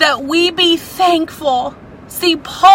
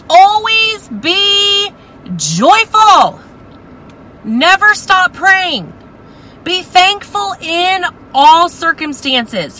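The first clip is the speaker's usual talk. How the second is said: high, slow, fervent reading; scripture read with feeling; 6 semitones higher than usual. Acted. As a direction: lift each phrase like a proclamation. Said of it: "It says".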